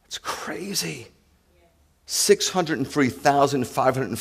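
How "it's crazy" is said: slow hushed whisper; whispered aside to the congregation. Real, not acted. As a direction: breathe it in disbelief. Hushed aside after numbers.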